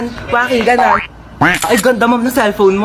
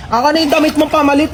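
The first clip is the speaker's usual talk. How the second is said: usual speaking voice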